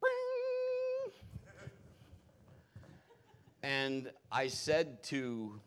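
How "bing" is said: very high sung bing, like a bell; sung ping. Retold or imitated. Hairs standing up, a high sung ping. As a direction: ring it out high as goosebumps rise. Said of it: "all the hairs on my body went".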